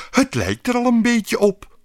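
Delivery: queen thrilled as the statue takes shape; enthusiastic. Acted. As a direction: burst out with delight. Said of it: "zei de koningin enthousiast".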